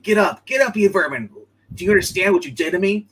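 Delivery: hammed-up hero yelling at a villain; mock angry shout. Retelling the fight, imitating Superman yelling at the villain.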